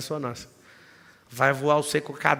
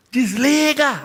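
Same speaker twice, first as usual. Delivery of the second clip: loud, much higher yell; retold yell; 10 semitones higher, 8 dB louder, much slower than usual. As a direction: order him to shut it down. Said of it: "E eu ficava assim". (grito alto e agudo mandando desligar)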